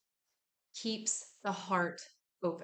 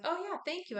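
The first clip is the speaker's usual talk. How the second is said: much higher, airy thank-you; mock grateful friend; 10 semitones higher, faster than usual. Friend thanking you for the comfort, much higher mock relief. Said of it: "And then they're like".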